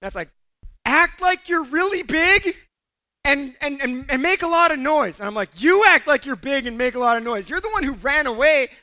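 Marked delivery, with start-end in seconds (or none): 0.8-5.2 higher, louder imitated friend calling out; mock-urgent raised voice; shouted advice. Friend calls advice from afar. 5.6-8.7 louder mock indignation; acted-out protesting comeback; indignant retort. Retorts to friend who fled.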